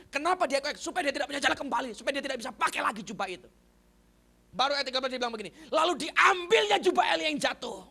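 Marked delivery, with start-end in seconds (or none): 5.7-7.8 loud, high, slow declamation; proclaiming scripture. Real, not acted. Preacher reading about Elijah's fallen mantle, proclaiming loudly. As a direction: proclaim the verse to the hall.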